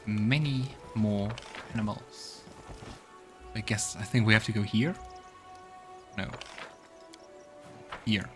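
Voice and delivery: ordinary delivery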